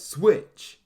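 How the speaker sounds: high, loud one-word shout; attack call. A narrator's reading. Ginyu sets off his trump card, called out loudly. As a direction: call out the move sharply.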